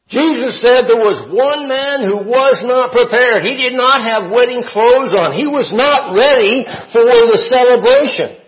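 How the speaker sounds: loud, high preacher's proclamation; forceful preaching. Real, not acted. Teacher telling the class of the unprepared guest, forceful preaching. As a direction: drive each clause home like a preacher.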